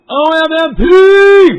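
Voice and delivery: very loud, slow drawn-out shout of a viewer's name; loud greeting call. Real, not acted.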